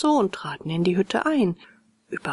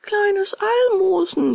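high, loud cat-like lament; wailing echo; 12 semitones higher, 5 dB louder than usual. A narrator's reading. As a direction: echo the begging in a piteous wail. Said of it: "jammerte die Katze hintendrein".

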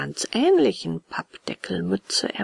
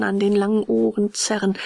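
plain reading voice

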